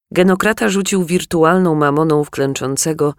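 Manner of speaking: ordinary delivery, nothing marked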